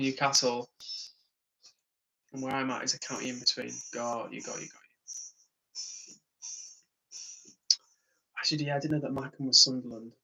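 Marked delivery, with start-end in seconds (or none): 3.9-6.1 slow whisper, much softer; whispered aside. Real, not acted. Streamer acknowledges a chat answer in a slow soft whisper. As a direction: breathe it out under the breath. Trailing acknowledgement, under breath.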